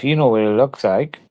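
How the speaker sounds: ordinary talk, nothing marked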